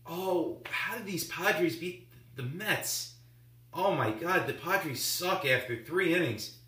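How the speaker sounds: high-pitched mocking social media fan; mock sarcastic. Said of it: "I'm gonna sound really sarcastic here".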